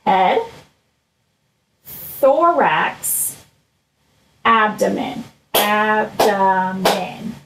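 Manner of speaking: slow sing-song call and response; drawn-out chanting for children; broad singsong kids theatre. Shown as a demonstration. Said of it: "Can you say that with me?".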